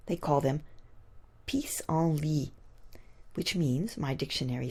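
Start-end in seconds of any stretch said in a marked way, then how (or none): 1.5-2.5 "pissenlit" whispered slowly in French, pee-sahn-LEE; French pronunciation. Shown as a demonstration. Poet giving the French name for dandelions, a slow whispered French word. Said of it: "The French have named them Frenchily".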